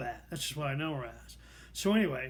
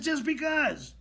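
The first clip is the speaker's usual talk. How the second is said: high, loud exasperated shout; shouting back; 9 semitones higher, 5 dB louder, slower than usual. Retold or imitated. Worn-down man answering coworker's questions, shouting back. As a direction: fire it back, fed up. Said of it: "I just shout back at her".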